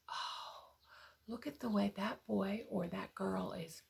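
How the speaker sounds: soft admiring voice. Acted. Imagined onlooker admiring child.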